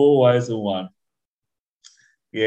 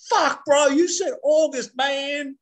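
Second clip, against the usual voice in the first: much higher, put-on voice, last line rushed; mocking impression of his mate; 11 semitones higher than usual. Friend mocks his mate's complaining voice, high and put-on. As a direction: ham up the friend's whiny complaint. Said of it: "you sound like this".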